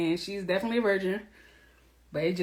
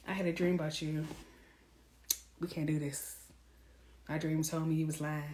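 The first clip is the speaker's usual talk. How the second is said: softer, calm and final; quiet matter-of-fact; 4 semitones lower, 10 dB quieter than usual. Retold or imitated. Telling a cheating man, calm and quiet. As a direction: dismiss him with a quiet shrug. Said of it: "Now I'm just like".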